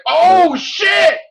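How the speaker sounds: loud, high "oh shit" yelled in thrill; excited shout. Retold or imitated. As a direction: yell it like the twist just hit you. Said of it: "And I'm like".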